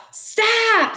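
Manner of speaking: high sharp scream; piercing shouted stop. A narrator's reading. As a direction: yell it out to stop him. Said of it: "screamed the guest".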